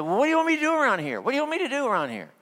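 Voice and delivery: high, fast exasperated demand; exasperated retort. Retold or imitated. Husband snapping back at wife in late-night fight, exasperated. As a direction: fire it back in the heat of a fight.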